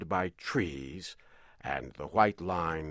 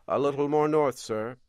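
higher, louder sailor voice; old sailor; 7 semitones higher, 5 dB louder, faster than usual. Acted. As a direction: answer the captain as an old sailor. Sailor answers the captain.

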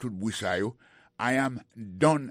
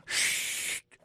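shushing. Shown as a demonstration. Teacher shows how Pete hushes people, hissed shush. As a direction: hush people with a breathy shh. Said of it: "se tout nan m ap di moun".

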